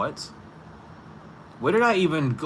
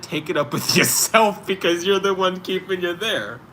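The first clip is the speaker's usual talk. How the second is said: laughing through words; 9 semitones higher, 7 dB louder than usual. Real, not acted. Laughing at own advice.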